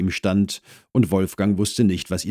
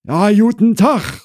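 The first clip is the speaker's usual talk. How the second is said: high, loud hesitant greeting; 9 dB louder than usual. Acted. Woman greets the new neighbour at her door, hesitant. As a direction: greet with some reserve, pitched high. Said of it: "sagte die Frau zögerlich".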